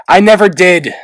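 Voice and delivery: almost shout your denial; nearly yelling. A narrator's reading. Clay denying he chose Peril, nearly yelling. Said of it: "Clay nearly yelled".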